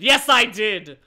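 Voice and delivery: loud, emphatic admission; dramatic confession. Acted. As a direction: declare it forcefully. Voices narrator's dramatic confession.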